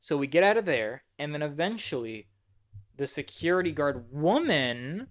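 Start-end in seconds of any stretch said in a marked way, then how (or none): none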